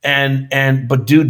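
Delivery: ordinary talk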